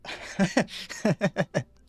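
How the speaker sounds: hammed-up commercial voiceover; mock ad read. A streamer pitching a fake canned product, mock ad voice. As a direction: sell the product like a cheesy TV advert.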